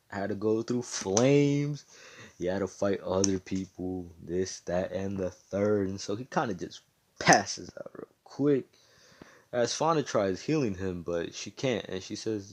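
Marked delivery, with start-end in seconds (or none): none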